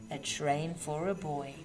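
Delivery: usual speaking voice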